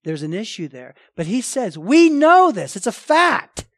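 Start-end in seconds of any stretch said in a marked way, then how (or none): 1.8-3.7 loud, higher, slow and forceful; ringing sermon emphasis; emphatic proclamation. Real, not acted. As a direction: preach it with full conviction.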